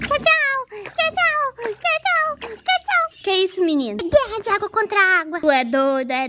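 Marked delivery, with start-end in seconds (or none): 0.1-3.2 high singsong goodbyes; playful child voice. Pretend. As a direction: tease in a little kid voice.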